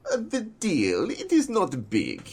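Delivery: put-on high-pitched, drawn-out Russian accent; imitating an actor's slow Russian-accented line reading. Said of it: "he said it in this Russian accent".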